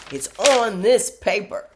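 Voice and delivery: loud, high, slow insistence; mock exasperated son. Son pointing mom to the printed page, loud and exasperated. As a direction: drive each word home in acted exasperation. Retold quarrel with her son.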